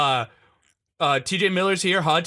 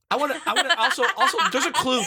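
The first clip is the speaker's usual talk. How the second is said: high put-on voice; 8 semitones higher than usual.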